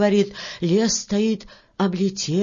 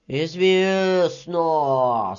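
very slow, drawn-out agreement; 3 semitones lower, much slower than usual. Acted. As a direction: stretch the word right out. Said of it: "согласился мужичок с чёрной бородой".

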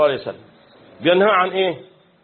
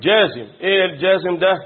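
ordinary delivery

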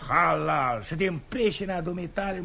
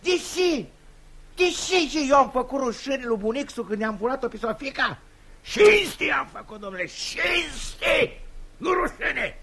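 outraged shout; 7 semitones higher than usual. Acted. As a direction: hammer the word cinste. Father defending his own elopement. (strigăt indignat, apăsat pe cinste)

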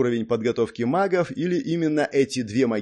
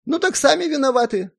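much higher, louder, smirking voice; sly Zak; 6 dB louder than usual. A narrator's reading. Zak to the hero about who is to blame, sly. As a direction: toss it off slyly, pitched right up. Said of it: "хмыкнул хитро прищурившись Зак".

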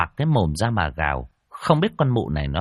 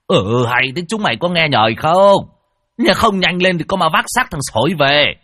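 loud, higher barked scolding; angry shout; 8 semitones higher, 7 dB louder than usual. Acted. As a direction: snap at the hesitating men. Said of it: "lão Tân quát". (quát tháo giận dữ)

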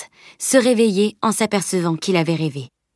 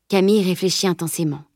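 plain reading voice

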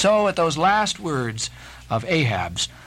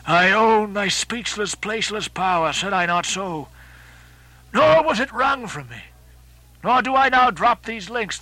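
raised, impassioned captain's oration; Ahab, defiant captain; 2 semitones higher than usual. Acted. Ahab defying the fire-spirit, impassioned and exalted. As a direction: hurl the words at the flames in proud defiance. Ahab's long speech to fire.

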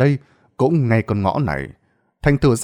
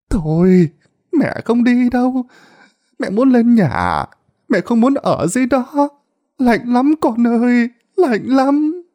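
frail higher pleading about the cold; pleading old woman; 12 semitones higher, 3 dB louder than usual. A narrator's reading. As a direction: beg him, shivering and tearful. Old mother begging to stay.

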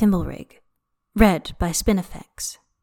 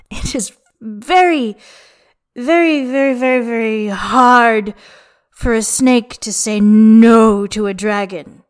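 put-on drunken lament; 2 semitones higher, 6 dB louder than usual. A narrator's reading.

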